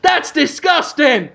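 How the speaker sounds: slow, high-pitched outburst of disgust; revolted TV-chef exclamation; Gordon Ramsay. Acted. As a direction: draw it out high, appalled. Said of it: "looks at it and goes".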